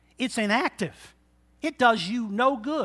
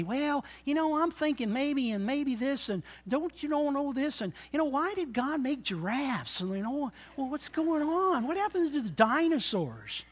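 a hint of a higher, hesitant skeptic; rambling doubter; 6 semitones higher, 6 dB quieter than usual. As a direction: dither through the excuses lightly. Doubter's rambling excuses voiced.

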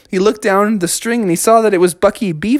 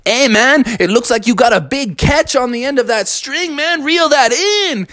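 higher, easygoing dude voice; cool iguana; 7 semitones higher than usual. Acted. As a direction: egg a buddy on, laid back. Iggy Iguana cheers Bucky on.

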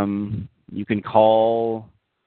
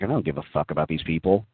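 ordinary delivery

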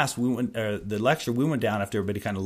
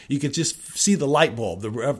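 ordinary delivery

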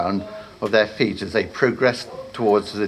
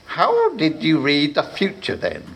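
lightly voiced warlock, a bit higher; pompous warlock; 6 semitones higher than usual. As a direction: ask like a vain wizard. Warlock questions apprentice.

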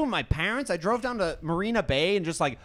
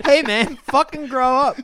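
mocking; 9 semitones higher, 8 dB louder than usual. Mocking a friend's line.

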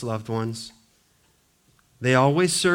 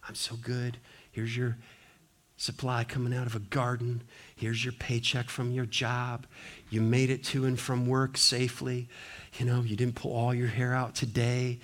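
soft whisper; 4 semitones lower, 8 dB quieter than usual. Acted. God whispering in pleasures.

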